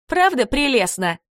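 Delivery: high, sugary mock delight; sarcastic. A narrator's reading. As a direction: pretend it is charming. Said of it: "заметила Карабелла".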